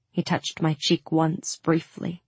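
ordinary delivery

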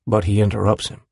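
ordinary delivery, nothing marked

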